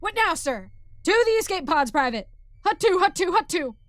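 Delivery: loud, high marching orders; military shouting. Acted. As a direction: shout it like a drill on the run. Sergeant marches private to pods.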